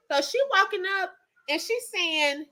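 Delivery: ordinary delivery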